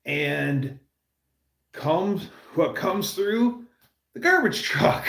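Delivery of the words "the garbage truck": laughing. Real, not acted. Laughing reveal of rescuer.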